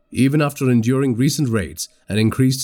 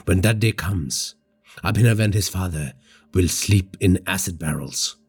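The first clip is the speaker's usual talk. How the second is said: calm, low, threatening gangster voice; low menacing cartel boss; 4 semitones lower than usual. Acted.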